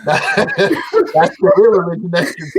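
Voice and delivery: speaking through real laughter; laughing comment. Real, not acted. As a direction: crack up while delivering the verdict. Listener cracking up at punchline.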